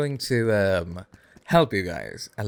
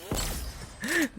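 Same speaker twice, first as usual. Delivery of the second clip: high giggle; 6 dB quieter than usual. Real, not acted. Laughs at own hype.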